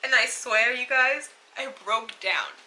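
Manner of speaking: tear-choked, breaking voice; real tears while telling it; crying now. Real, not acted. Telling viewers how she broke down, crying for real. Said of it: "Literally how I am right now".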